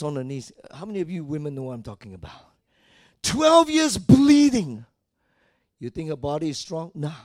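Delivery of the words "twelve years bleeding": slow, high, loud exclamation; full-force preaching. Acted. Preacher stressing the woman's long suffering, full-force preaching. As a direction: drive it home with a pulpit shout.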